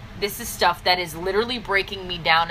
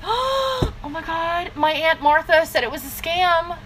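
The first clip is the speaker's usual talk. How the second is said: mocking a scared recruit who heard it was a scam, whining; whiny mock distributor; 6 semitones higher than usual.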